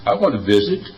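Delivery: ordinary talk, nothing marked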